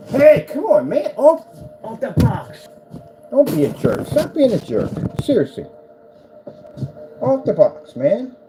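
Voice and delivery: loud, high scold; scolding the cat. Real, not acted. Scolding the cat off the box, loud and high. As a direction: shoo him off the box.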